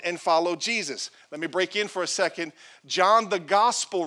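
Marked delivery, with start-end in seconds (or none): none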